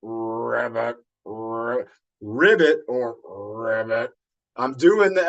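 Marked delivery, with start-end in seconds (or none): 0.0-4.1 low, squashed frog croak; squashed vocal cords. Shown as a demonstration. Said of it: "I gotta smush my vocal cords".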